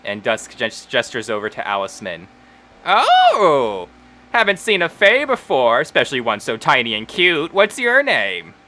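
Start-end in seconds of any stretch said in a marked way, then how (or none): 2.8-8.5 high, cooing surprise with a long swooping "oh"; delighted halfling Wendy. Acted. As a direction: gush over something tiny and cute, drawing out the end. Voices Wendy cooing over tiny fae.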